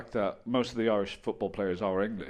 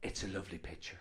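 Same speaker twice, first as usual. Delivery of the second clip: much softer, lower, mild voice; mum's soft remark; 6 semitones lower, 13 dB quieter than usual. Acted. Mum ignoring the scandal in the article, soft mild remark. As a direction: say it small and low. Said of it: "She went".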